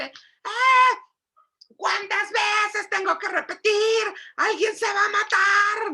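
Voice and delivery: high, loud mock hysterical scream; hysterical yelling. Mother screaming at her kid over the messy living room, hysterical yell. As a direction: shriek like a mother at the end of her rope. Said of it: "gritas como loca histérica".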